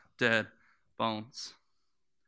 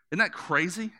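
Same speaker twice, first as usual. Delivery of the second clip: ordinary delivery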